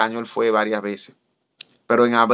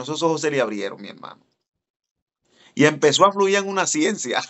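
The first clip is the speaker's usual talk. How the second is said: ordinary talk, nothing marked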